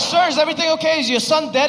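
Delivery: high, puzzled operator voice; a hammed-up concerned operator; mock operator. Operator questions the father, in a high puzzled voice. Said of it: "It's like".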